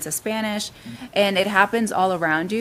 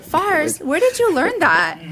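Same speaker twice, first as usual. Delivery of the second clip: surprised; 10 semitones higher, 6 dB louder, slower than usual. Retold or imitated. Amazed reaction to coworker.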